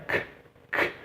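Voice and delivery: bare "k" click, repeated; som isolado do K. Shown as a demonstration. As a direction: release a lone "k" twice. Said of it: "O som do K". ("k" seco, sem vogal)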